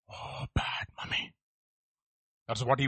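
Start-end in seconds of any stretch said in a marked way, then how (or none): 0.1-1.3 slow hushed tut-tut; sly whispered sympathy; mock whisper. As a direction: whisper it like a conspirator. Child's complaint mocked.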